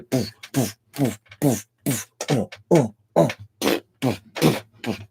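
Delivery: thumping boof beat sounds; rave beat imitation. Describing Finnish rave songs, boof beat imitation. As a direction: mimic a house party bass drum.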